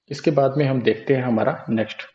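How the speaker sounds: ordinary delivery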